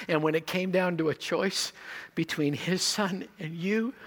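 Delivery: usual speaking voice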